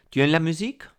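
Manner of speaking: "Tu aimes la musique?" asked with pitch climbing high to the end; rising question intonation. Shown as a demonstration. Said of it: "con una entonación que suba". (entonación ascendente de pregunta, subiendo en "musique")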